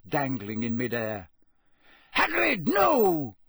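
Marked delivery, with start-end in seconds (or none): 2.2-3.4 high, loud, urgent shout. A narrator's reading. Dumbledore stopping Hagrid's attack, shouting. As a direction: shout to stop him. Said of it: "Dumbledore shouted".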